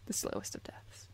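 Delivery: creepy breathy whisper. Pretend. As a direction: hiss it ominously. Ominous aside after "slow death.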